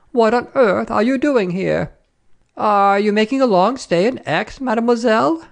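high, insistent questioning madame; prying lady traveller. Acted. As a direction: interrogate her with mounting curiosity.

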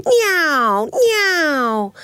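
high imitated plane whine, falling away; two zooming plane swoops; aeroplane noise. A narrator's reading. Baby Bear playing aeroplane in bed, imitated engine whine. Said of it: "pretending to be an aeroplane".

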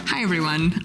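normal talking voice

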